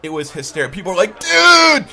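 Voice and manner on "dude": loud, high surfer-style shout; imitated excited reunion cry; hollered greeting. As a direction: yell it like a delighted bro. People greet supposed Aaron.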